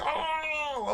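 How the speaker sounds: high yelped oh, mimicking a man falling; hammed-up yelp; mock cry of alarm. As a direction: squeak out a fake cry of alarm. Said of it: "He's like".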